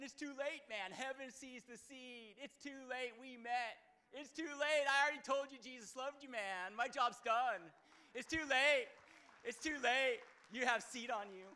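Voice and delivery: loud, high, gloating declaration; hammed-up triumphant yell; mock triumphant shout. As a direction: shout it like a victory cry. Preacher acting out bold declaration.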